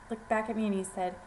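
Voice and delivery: usual speaking voice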